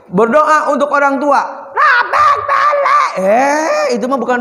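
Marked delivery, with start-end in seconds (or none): 1.7-3.1 very high, slow squeal of a mangled prayer; mock garbled prayer. Preacher mocks a child's botched prayer in a high, slow squeal.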